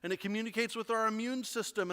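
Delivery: usual speaking voice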